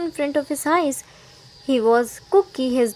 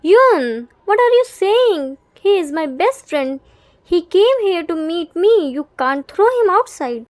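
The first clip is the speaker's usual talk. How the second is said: protesting; 3 semitones higher, 4 dB louder than usual. Acted. Jimin protests to Yoongi.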